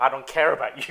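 exasperated loud quote; loud mock declaration. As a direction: blurt it out loudly, quoting him. Retold film line, indignant.

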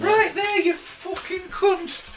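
high, slow ghost, breaking into laughter. Acted. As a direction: insult him as a cheeky spirit. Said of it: "Please stop with the ghost voice".

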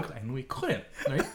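usual speaking voice

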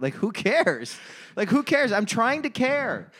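worked-up, high-pitched exasperation; exasperated rant. Real, not acted. Fan fed up with endless baseball games, exasperated. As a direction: voice climbing in frustration.

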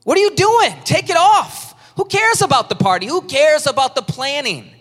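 high, loud, insistent no; Jesus voice. Retold or imitated. Preacher voicing Jesus telling the weary to take it off, high and urgent. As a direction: urge them to drop the load. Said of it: "here's what Jesus is saying".

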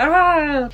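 high, loud mock cry; drawn-out, hammed-up "aah"; imitated music-video cry. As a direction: wail it like a music-video drama queen. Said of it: "hon bråkade med någon kille, bara".

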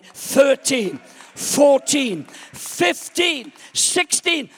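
high, very loud counting; shouted referee count. Acted. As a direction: count with rising fervor. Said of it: "Come on, somebody".

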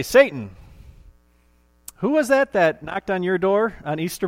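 high, loud triumphant challenge; preacher defying Satan at the door, loud and triumphant; defiant preaching. Retold or imitated. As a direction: stand up and throw it back at Satan. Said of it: "You're gonna say".

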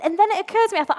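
ordinary delivery

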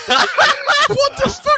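very high, slow, laughing "What the fuck?"; laughing disbelief. Real, not acted. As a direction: stretch it out while cracking up in disbelief. Reacting to comic accent story.

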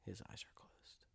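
very soft commentator's whisper; hushed confidential aside. Real, not acted. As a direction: whisper it so he stays in the moment. Hushed aside while other imagines.

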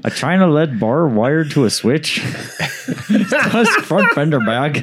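put-on light Canadian accent, laughing; Canadian accent with laughter. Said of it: "say this in a Canadian accent".

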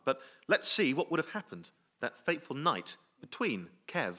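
normal talking voice

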